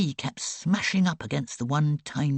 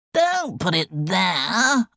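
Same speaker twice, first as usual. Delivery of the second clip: put-on slow, louder sick-boy howl; 5 semitones higher, 6 dB louder, much slower than usual. A narrator's reading. Sick boy howls at girl over the bowl, slow and loud. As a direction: howl it out in a panic. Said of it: "Ivor howled".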